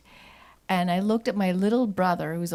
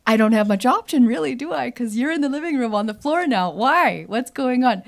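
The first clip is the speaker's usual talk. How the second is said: louder, half-joking protest; louder mock exasperation to mom on the floor; retold loud reply; 4 semitones higher, 9 dB louder than usual. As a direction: call it out to mom across the room.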